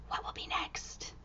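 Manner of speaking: very soft hushed whisper. Acted. Reader to children, whisper. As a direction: tease the next page.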